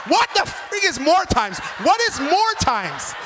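high-pitched, incredulous demand; exasperated, high and drawn out; baffled outcry. Acted. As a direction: cry out in utter bafflement. Baffled reaction to suitor's slang.

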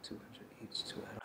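whispered Two hundred each in disbelief; whispered echo of the price; stunned whisper. Retold or imitated. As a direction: breathe Two hundred each, barely audible. Repeating the price in shock.

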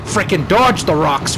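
ordinary talk

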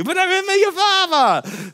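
over-eager correction; bright sing-song insistence; mock insistence.

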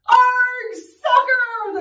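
high, loud crew cheer; yelling. Acted. Crew echoes captain's kickoff cry, yelling. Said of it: "the crew yelled".

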